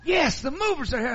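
high, loud, giddy announcement; giddy mother with happy news. Retold or imitated. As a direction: bubble it out like a giggling mother. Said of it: "giddy and giggling".

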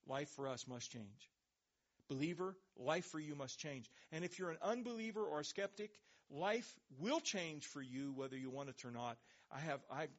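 usual speaking voice

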